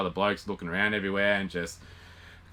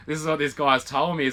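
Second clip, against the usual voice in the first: ordinary talk, nothing marked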